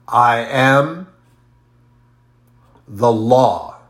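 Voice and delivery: loud, emphatic declaration of I am the law; declaring. Retold or imitated. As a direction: proclaim it with full force. Quoting Jesus declaring himself.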